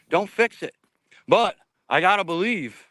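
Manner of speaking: normal talking voice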